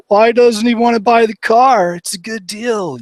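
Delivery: high, slow, teary whimper of a voice; hammed-up blubbering over a car commercial; mock weepy crying. Retelling crying at commercials, in a high mock weepy voice. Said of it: "I'd be crying at random stuff".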